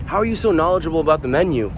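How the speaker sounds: mild imitation of an inquisitive regular; curious customer. Table asking their server about the menu, lightly mimicked curiosity.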